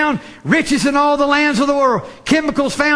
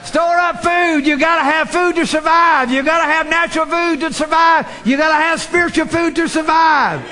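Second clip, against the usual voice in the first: full-force preaching; 5 semitones higher than usual. Acted. Preacher urging congregation to store food, shouting. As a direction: hammer home the call for spiritual food.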